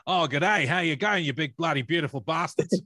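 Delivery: loud, high, hammed-up Australian greeting; broad, boisterous Aussie accent; Aussie Man. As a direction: belt it out like an over-the-top Australian host. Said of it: "that sounds more like Aussie man".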